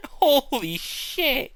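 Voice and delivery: high, slow, stretched-out laugh; laughing disbelief. Real, not acted. Streamer reacting to a chat joke, laughing in shock. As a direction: drag it out while cracking up.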